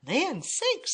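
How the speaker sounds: high mama bear exclamation; middle-sized Mama Bear voice. A narrator's reading. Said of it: "said Mama Bear in her middle-sized voice".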